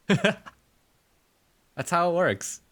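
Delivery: drawn-out laughing delivery. Real, not acted. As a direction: laugh through the words slowly. Laughing at own joke.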